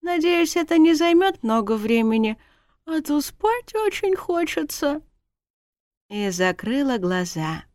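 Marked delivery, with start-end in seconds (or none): 0.0-5.0 high, drowsy whine; yawning, sleepy. A narrator's reading. Wife falling asleep in husband's arms, sleepy yawning. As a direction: drift off while complaining. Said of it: "зевнула".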